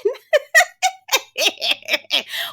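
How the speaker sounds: high burst of laughter. Real, not acted. Vlogger cracking up at jivey, high laughter. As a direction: crack up at the word.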